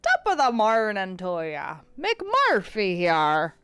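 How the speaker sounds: hammy, drawn-out Irish greeting; rough Irish accent. Acted. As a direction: do a shaky attempt at Irish. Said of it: "I haven't practiced my Irish".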